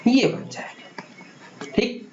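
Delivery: slow hushed whisper; whispered aside. Acted. Teacher revealing the product to students, slow whisper. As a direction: breathe it out like a reveal.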